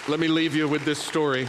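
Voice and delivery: ringing preacher call to listen. Real, not acted. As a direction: lift the room into the story. Preacher announces story.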